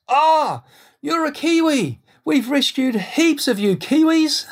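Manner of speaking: very high, exclaimed German-accented mimicry; mock German accent, slow and high-pitched; thick German accent. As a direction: imitate a German officer in a high, drawn-out voice. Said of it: "in his thick German accent".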